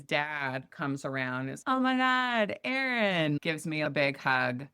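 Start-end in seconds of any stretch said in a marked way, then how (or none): 1.7-3.4 high, gushing hello; imitating an overjoyed old family friend; excited greeting. Ex's dad greets warmly.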